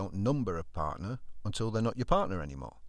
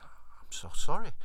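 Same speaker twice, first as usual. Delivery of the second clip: apologetic; 7 semitones higher, 5 dB louder, slower than usual. Retold or imitated. Wife apologising.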